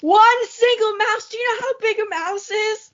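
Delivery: outraged at one tiny mouse, hammed up; mock-indignant shriek; incredulous outburst.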